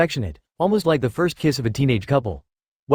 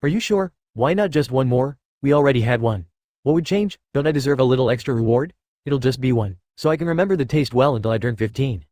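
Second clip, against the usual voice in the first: ordinary delivery, nothing marked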